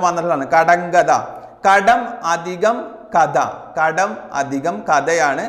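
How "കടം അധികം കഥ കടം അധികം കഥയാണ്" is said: each part drawn out; slow dictation. Shown as a demonstration. Teacher to exam students, slowly spelling out the split. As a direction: spell the pieces out for the class. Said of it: "teacher dictates the split form".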